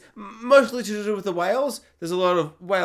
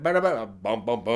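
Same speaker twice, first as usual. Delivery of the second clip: imitated soundtrack riff; 8 semitones lower than usual. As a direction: mimic the film score with syllables, sinking lower. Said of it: "At one point it went".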